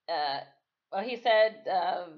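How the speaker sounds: ordinary delivery, nothing marked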